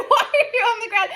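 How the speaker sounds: high, loud, amused disbelief; incredulous question. Retold or imitated. Boyfriend finds her on the ground, mock incredulous. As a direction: imitate bewilderment, close to laughing.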